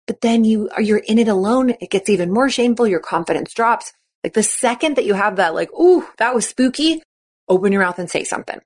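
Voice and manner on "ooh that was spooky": high ooh, a little shaken; light mock fright at the scare; high playful spooked ooh.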